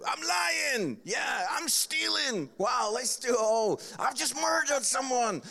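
loud, high, hammed-up shout from the crowd; mock shouted confession. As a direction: yell it out like a heckler showing off. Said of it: "people shouting out".

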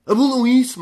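"Abulam" bellowed high and loud; hammed-up protest cry; mock rally shout. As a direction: yell it like a protest chant.

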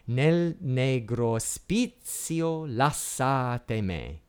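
slow, measured Italian poetry recitation; chant-like reading, stretching each line's accented syllable; declaimed Italian verse. Shown as a demonstration. Lecturer reads Medea's incantation text for students to spot the effect, slow declaimed verse.

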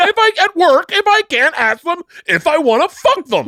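very high, strained indignant protest; mock outraged rant. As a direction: imitate a man exasperated by the rules, pitch way up. Plays the guy ranting about rules.

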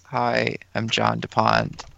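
soft, high, slow creepy drawl; John DuPont impression. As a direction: imitate his eerie gentle way of talking. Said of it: "he just goes into this".

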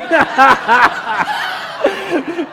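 loud laugh at his own joke; real burst of laughter. Real, not acted.

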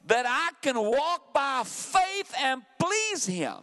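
high, slow, ringing preacher's voice; preaching. Acted. Pastor preaching to the church about faith, fervent. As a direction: lift it up and stretch each phrase for the church.

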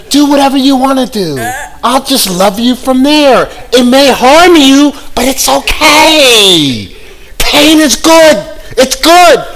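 high, slow, syrupy mock kindness; mock saintly sweetness. Preacher mocking naive believers, high and syrupy sweet. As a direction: drip fake sweetness, drawn out.

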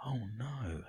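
very soft, feigned horror; hushed mock dread. Host reacts to creepy story, hushed mock horror. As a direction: breathe it out in pretend dread.

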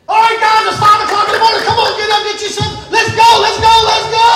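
loud, high, insistent wake-up shouts; rousing the troops. Acted. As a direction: push them out of bed and into battle. Rousing troops on day twenty.

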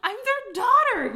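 slow, high, loud cry; a girl crying out to the reporter; cried out. A narrator's reading. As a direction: call it out, drawn out and pleading. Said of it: "Eudora cried".

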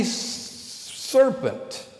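breathy hissing whisper of serpent; hissed serpent. Shown as a demonstration. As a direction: hiss it like a snake. Preacher hisses serpent word.

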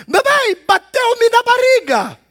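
put-on high, slow whiny child voice; Kiko's whine. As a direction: whimper it like a spoiled kid complaining. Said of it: "Ela disse".

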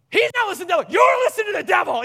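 high, full-volume retort; mock shouting mother. Wife turning back to their son in the car, high mock shouting. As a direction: imitate a parent shouting back at the kid.

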